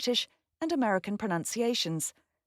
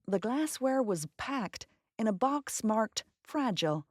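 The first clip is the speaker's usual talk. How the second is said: slow American accent demo, FRAGILE as "fraj-ul". Shown as a demonstration. Narrator demonstrates the word in a sentence for learners, American accent. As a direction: put on an American accent and take the sentence slowly. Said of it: "In an American accent".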